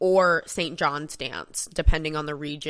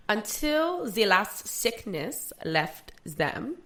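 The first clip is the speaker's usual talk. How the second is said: poem read aloud in a hammed-up German accent, pitched higher; mock German accent; 4 semitones higher than usual. Podcaster reads the plague poem to her cohost in a mock German accent. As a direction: recite the old poem in a put-on German accent, higher and slower in places. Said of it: "Can you do a German accent?".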